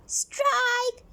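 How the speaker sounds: put-on high excited yell of the boys. A narrator's reading. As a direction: shout it out high, like a cheer. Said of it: "yelled the boys".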